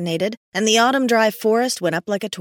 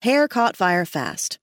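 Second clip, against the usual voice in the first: ordinary delivery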